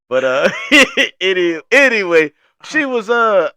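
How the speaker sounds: high and loud, laughing into the words; still laughing. Real, not acted. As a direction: fight the laugh to get back to the story. Still laughing, trying to resume.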